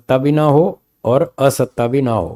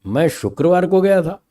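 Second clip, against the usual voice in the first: शुक्रवार lifted higher and pressed; शुक्रवार raised higher; stress on शुक्रवार; 4 semitones higher than usual. Shown as a demonstration. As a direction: stress शुक्रवार so it means "on Friday, not another day". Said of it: "और सुर बदलता हूँ".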